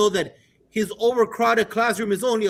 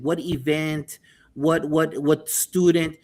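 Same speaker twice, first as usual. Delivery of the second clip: ordinary talk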